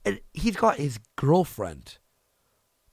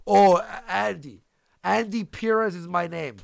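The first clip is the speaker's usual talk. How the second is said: normal talking voice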